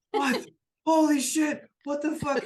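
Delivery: high, loud bursts of shock; stunned. Acted. Crew reacting to the newcomers, stunned.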